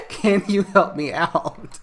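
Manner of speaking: a hammed-up dad begging for help; mock pleading dad. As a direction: ham up a needy father asking for help. Dad's plea voiced in recap.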